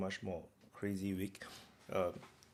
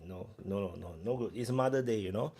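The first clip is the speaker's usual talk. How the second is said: usual speaking voice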